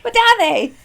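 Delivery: high, loud disbelieving question; suddenly doubt it out loud; incredulous. Real, not acted.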